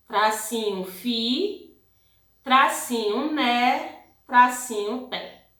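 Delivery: put-on slow, carefully split syllables for children; teacher dictating each syllable slowly; syllable-by-syllable dictation. Shown as a demonstration. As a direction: spell it out one beat at a time. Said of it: "Como que a gente vai copiar?".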